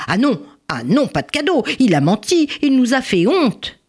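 high-pitched, cross objection; indignant mother. Acted. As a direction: cut in with mounting indignation. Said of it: "Alors sa mère s'interposa". (mère indignée qui s'interpose)

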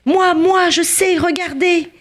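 high, loud, fervent outburst; exalted cry. A narrator's reading. Prisoner Joseph calling out to the barrack, exalted cry. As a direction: cry out suddenly, bursting with eager faith. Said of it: "cria tout à coup plein d'une foi exaltée".

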